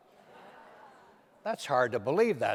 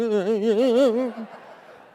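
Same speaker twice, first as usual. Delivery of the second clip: pained laugh; 8 semitones higher, 10 dB louder than usual. Retold or imitated. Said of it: "I was going".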